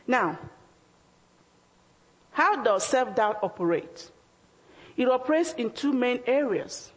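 ordinary delivery

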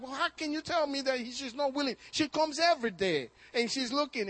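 high, loud, fast retort thrown back at God; frustrated believer yelling back at the Lord, loud and high. Retold or imitated. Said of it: "I yelled back at him and said".

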